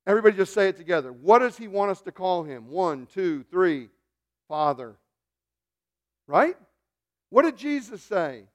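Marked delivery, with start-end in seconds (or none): none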